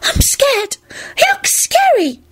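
very high frightened squeak; frantic fear. A narrator's reading. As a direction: whimper it in a tiny high voice. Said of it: "Serena whispered frantically".

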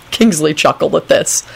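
usual speaking voice

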